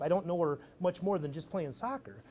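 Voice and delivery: normal talking voice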